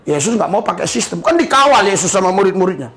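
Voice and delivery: ordinary delivery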